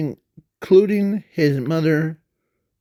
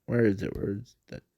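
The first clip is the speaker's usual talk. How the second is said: muttering to self; 6 semitones lower, 10 dB quieter, faster than usual. Real, not acted. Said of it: "let me find it".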